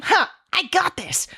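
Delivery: high, triumphant "Ha!"; a smug anime boast; gleeful meme cry. Retold or imitated. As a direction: gloat like an anime villain. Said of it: "the Kill la Kill meme".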